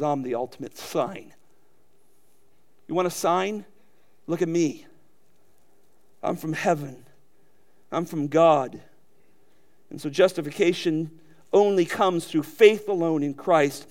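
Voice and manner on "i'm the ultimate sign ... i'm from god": calm reply. Retold or imitated. Preacher voicing Jesus' reply.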